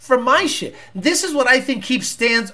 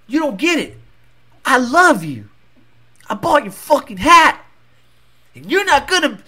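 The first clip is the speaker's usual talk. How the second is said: higher-pitched, worked-up acted pleading; obsessed fan; 3 semitones higher than usual. Snubbed fan confronting his idol, acted desperate intensity. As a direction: act a fixated fan building toward an outburst. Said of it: "that guy's gonna go".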